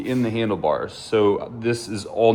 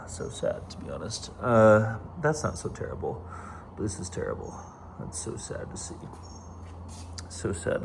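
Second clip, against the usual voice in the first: very soft, near-whispered sadness; dejected; 10 dB quieter than usual. Real, not acted. Looking over the wrecked bike, quietly dejected. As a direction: trail off quietly, heartsick.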